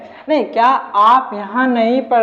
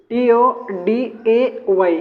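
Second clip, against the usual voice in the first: letters spelled out slowly; slow spelling; much slower than usual. Shown as a demonstration. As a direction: spell today one letter at a time. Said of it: "today". (Today के अक्षर धीरे-धीरे)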